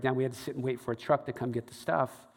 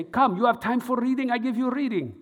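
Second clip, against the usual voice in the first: put-on higher, coaxing Eastern European accent; 9 semitones higher, 4 dB louder than usual. Fortune teller coaxing him in, in Eastern European accent. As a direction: beckon him in with her accent. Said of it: "she would say".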